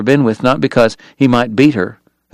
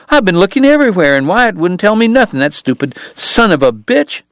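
much higher, fast indignant woman's voice; Kate ranting; 8 semitones higher than usual. Acted. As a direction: vent about Wyatt. Kate complaining angrily to Doc.

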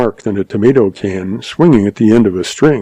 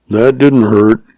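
ordinary delivery

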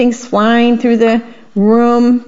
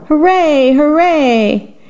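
cheering; 5 semitones higher, slower than usual. A narrator's reading. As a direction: cheer like a happy crowd. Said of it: "the audience shouted".

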